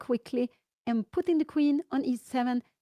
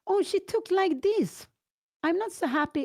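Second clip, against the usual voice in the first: surprised; 7 semitones higher than usual. Real, not acted. Commentator caught off guard by Anna's capture, surprised. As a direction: react to a move you didn't want. Unexpected move by Anna.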